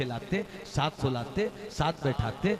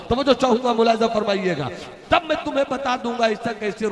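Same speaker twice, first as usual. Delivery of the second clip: heightened preaching; 7 semitones higher, 7 dB louder, faster than usual. Acted.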